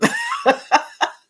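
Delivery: high delighted cackle; high burst of laughter. Real, not acted. Host cracks up at her cousin's comment, high laugh.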